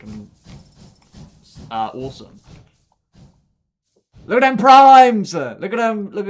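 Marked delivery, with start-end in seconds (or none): 4.3-6.3 higher, louder, thrilled; excited exclamation. Real, not acted. Showing chat the locomotives, loud and thrilled. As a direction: show off the trains with glee.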